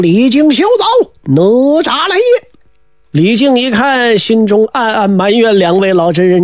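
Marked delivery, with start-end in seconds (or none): 0.0-2.5 slow, higher challenge yelled after a fleeing man; battle cry. A narrator's reading. Nezha yelling at Li Jing to stop. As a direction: call out his name like a war cry. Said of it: "大叫一声".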